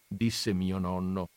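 ordinary delivery

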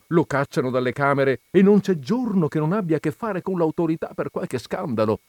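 ordinary delivery, nothing marked

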